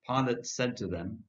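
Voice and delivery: ordinary delivery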